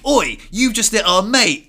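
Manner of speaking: loud, high, slow mock challenge; angry confrontation. Mates confronting the man who hit their friend, angry. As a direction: call him out loudly, imitating angry mates. Imagined mates turning on him.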